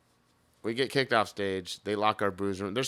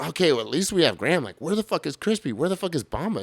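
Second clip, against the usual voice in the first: raised, loud re-enacted exasperation; exasperated; 5 semitones higher, 7 dB louder, faster than usual. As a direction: demand answers, pitched up and loud. Acted frantic search for bandmates.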